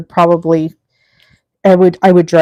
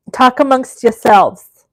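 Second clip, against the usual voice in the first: put-on slow, higher New York accent; 4 semitones higher, 4 dB louder than usual. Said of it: "That's my New York accent".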